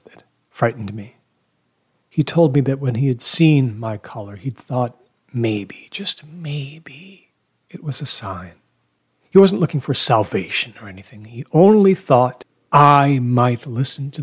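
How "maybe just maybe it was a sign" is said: slow, softly wondering; tentative hope. A narrator's reading. Man recalling a possible sign in the collar, tentative hope. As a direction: let the words hang, hushed and hopeful.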